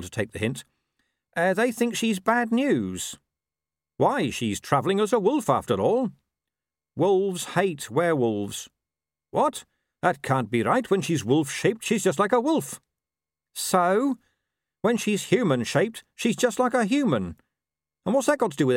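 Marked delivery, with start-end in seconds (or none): 1.4-8.7 high-pitched, drawn-out dog voice; gossiping dog. Acted. Dog reports the howl. 9.3-17.4 high-pitched, puzzled earnest objection; Carrot, protesting. Acted.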